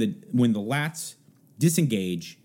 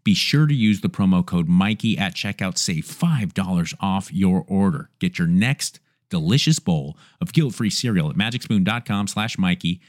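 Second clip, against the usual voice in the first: ad read; 4 semitones lower, 3 dB louder than usual. Acted. Host reads sponsor ads.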